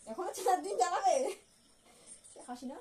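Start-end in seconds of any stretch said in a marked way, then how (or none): 0.1-1.3 dismayed surprise. Real, not acted. Wife hears four more days.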